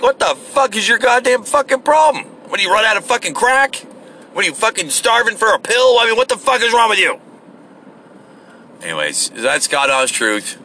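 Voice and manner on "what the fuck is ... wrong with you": high furious shouting at the intruder; angry yell. Retold or imitated. Yelling at the guy who came at his door, furious and high. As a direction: chew him out at full volume, voice way up. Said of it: "I'm like".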